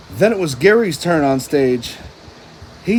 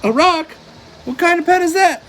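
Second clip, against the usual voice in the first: high, mocking laugh in the voice; much higher, quick amused scoff; laughing disbelief; 11 semitones higher, faster than usual. A narrator's reading. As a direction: scoff through giggles. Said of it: "SpongeBob laughed".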